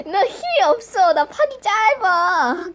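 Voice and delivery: normal talking voice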